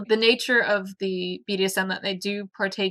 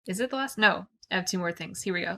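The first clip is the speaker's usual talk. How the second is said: normal talking voice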